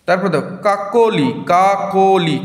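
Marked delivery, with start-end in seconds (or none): 0.6-1.3 "Kakoli" loud and high, in clear beats; syllable demonstration. Shown as a demonstration. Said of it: "কটা অক্ষর আসছে". 1.5-2.4 "কাকলি" loud and high with কা held apart; syllable demonstration. Shown as a demonstration. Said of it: "কটা অক্ষর আসছে".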